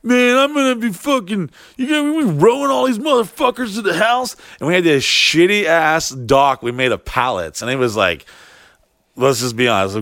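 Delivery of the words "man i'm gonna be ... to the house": high-pitched imitated griping; mock bitching. Friend griping about rowing guests to the house, high mock complaint. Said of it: "I remember him bitching".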